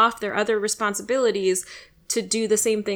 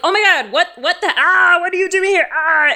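loud, high mock-panic yelling; panicked shock; 9 semitones higher, 8 dB louder than usual. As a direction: shriek in hammed-up alarm. Acts the expected panicked response.

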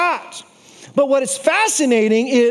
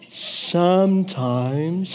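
mock slow-motion delivery; very slow drawn-out word; 7 semitones lower, 4 dB quieter, much slower than usual. Shown as a demonstration. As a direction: stretch sometimes out very slowly, low and soft. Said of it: "Let me say it slower".

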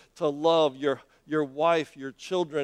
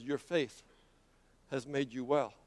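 soft, lower voice with a pause; gentle, quiet pronouncement; 4 semitones lower, 9 dB quieter than usual. Acted. Jesus to the healed man, quiet and gentle. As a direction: say it low and hushed, unhurried.

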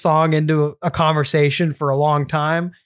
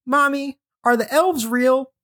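put-on higher, slow childlike voice; little girl asking; 8 semitones higher than usual. Little sister asking mom in the car, higher childlike voice. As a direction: play the little sister asking, wide-eyed. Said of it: "she goes".

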